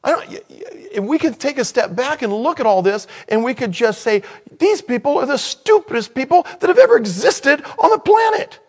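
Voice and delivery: high-pitched exasperated exclamation; incredulous outburst. Real, not acted. Preacher stepping back to judge the people, loudly incredulous. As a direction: climb very high, ending loud on the verdict.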